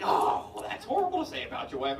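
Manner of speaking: high, fast prissy disapproval; tut-tut like a scandalised stranger; scandalised high voice, quick; shocked onlooker. Retold or imitated. Strangers scolding the dad.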